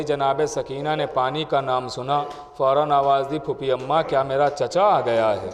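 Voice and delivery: subdued Urdu majlis recitation; sermon storytelling. Acted. Majlis narration of Karbala story.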